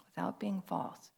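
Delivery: breathy whispered echo; a soft whispered aside. Shown as a demonstration. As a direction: whisper it back to yourself. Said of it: "whispered repeat".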